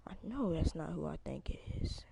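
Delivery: hushed unvoiced disbelief; whispering. Real, not acted. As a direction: breathe it out in a whisper. Repeats line, first whispered.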